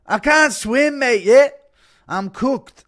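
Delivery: very high, loud Irish panic; McGregor impression. Fighter on a sinking ship shouting, loud mock Irish panic. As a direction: yell it like a drowning Irish fighter. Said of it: "Impression of McGregor on the Titanic as it sinks".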